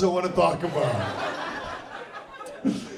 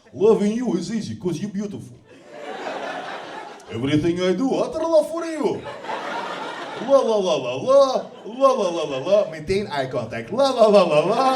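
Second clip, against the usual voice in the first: louder romantic crooning in a Russian accent; 4 dB louder than usual. Shown as a demonstration. Romantic ballad parody, sung in a Russian accent. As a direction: croon love lines in a heavy Russian accent. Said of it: "If you make it romantic".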